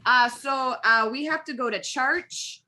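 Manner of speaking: put-on Wisconsin accent. Friend asked for a Wisconsin accent, answers in a light Wisconsin accent. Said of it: "can you give me a Wisconsin accent".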